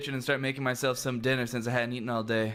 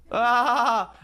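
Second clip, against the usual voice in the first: a short, higher and louder laughing ah; 7 semitones higher, 6 dB louder than usual. Acted. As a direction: let a bright laugh burst out as the ah. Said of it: "Coral laughs".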